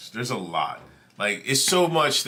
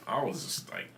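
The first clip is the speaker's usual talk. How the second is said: usual speaking voice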